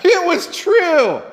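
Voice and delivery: high, drawn-out mock astonishment; amazed excitement. Disciples reporting back to Jesus after their mission, hammed-up excitement. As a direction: play the disciples thrilled and amazed. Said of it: "how excited they were about this".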